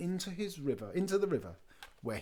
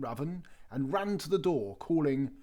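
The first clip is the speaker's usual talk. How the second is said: ordinary delivery, nothing marked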